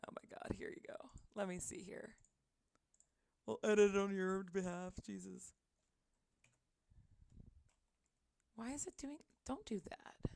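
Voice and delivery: soft, annoyed muttering off-mic; muttering to herself. Real, not acted. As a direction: mumble it under your breath. Muttering to herself while fixing settings.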